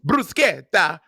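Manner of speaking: "bruschetta" slowly drawn out, bru-SKET-ta; exaggerated pronunciation. Shown as a demonstration. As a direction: overdo "bruschetta" syllable by syllable. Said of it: "I'm not saying bruschetta".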